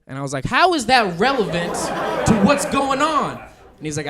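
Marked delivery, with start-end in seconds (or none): 0.4-3.4 louder, higher exasperated protest; indignant bafflement. Retold or imitated. Pulled-over driver challenging the cop's odd question, baffled and indignant. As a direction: demand it loudly, thrown by the question.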